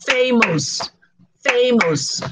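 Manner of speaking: "famous" in two even beats, FAY-MOUS; syllable by syllable. Shown as a demonstration. Teacher shows learners two syllables, syllable by syllable. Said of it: "Two syllables".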